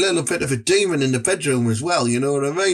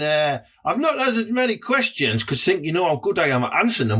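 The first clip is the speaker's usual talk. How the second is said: usual speaking voice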